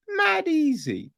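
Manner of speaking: MAD EASY way up high, falling at the end; high-pitched emphasis. Real, not acted. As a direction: squeak out "mad easy" in disbelief. Repeats it in high-pitched disbelief.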